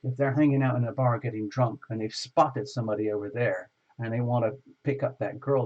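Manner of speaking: ordinary talk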